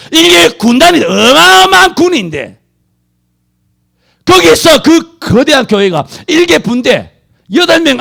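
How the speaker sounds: high, loud, shouting sermon; fiery preaching. Real, not acted. Preacher scolding megachurches at full force, shouting.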